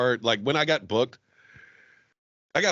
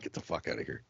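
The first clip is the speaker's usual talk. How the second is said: low, quiet mock brush-off; mock dismissal; 11 semitones lower, 13 dB quieter than usual. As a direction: throw him out under your breath. Playing bar owner ejecting his friend.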